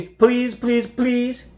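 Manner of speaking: 1 pleading. A narrator's reading. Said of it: "with each plea".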